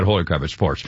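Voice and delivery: normal talking voice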